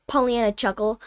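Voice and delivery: ordinary delivery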